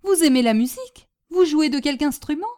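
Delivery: loud question, rising at the end; eager astonishment. Acted. Hostess finding out her guest loves music, eagerly astonished. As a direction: ask in excited disbelief.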